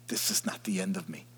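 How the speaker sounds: hushed inner vow; whispered. Acted. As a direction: whisper the repeat softly to yourself. Repeats affirmation as whisper.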